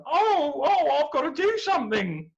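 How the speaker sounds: high, loud, flustered hero; eager hero. Acted. Perseus spotting Andromeda, high and eager. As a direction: rush to the rescue.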